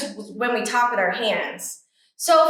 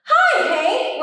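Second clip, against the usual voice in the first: higher, louder friendly greeting from a tumbleweed; called out cheerfully; 8 semitones higher, 3 dB louder than usual. Acted. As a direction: call out a sunny hello. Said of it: "Rosie the tumbleweed called out".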